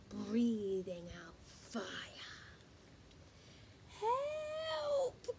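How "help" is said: very high shriek for help; the witch crying out in panic; panicked witch cry, pitched high; frightened cry. Acted. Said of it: "cried the witch".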